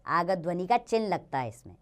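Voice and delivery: normal talking voice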